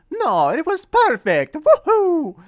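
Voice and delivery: high, loud cartoon Mario cheer; excited Italian-accented Mario with a rising woohoo. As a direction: bounce it up like the cheerful plumber, ending in a big whoop. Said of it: "doing the regular Mario voice".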